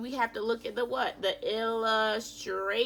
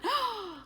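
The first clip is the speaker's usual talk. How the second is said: sharp, high gasp; surprised gasp; 11 semitones higher, 3 dB quieter than usual. Real, not acted. Teacher reading to class, gasping at the porcupine dance. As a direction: gasp at what happened.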